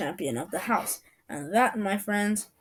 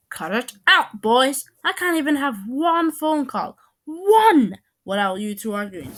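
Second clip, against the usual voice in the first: high, loud exasperated scolding; fed-up mother's outburst; storming mom; 5 semitones higher, 6 dB louder than usual. A narrator's reading. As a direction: tell the boys off at full voice. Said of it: "Mom stormed in like a boss".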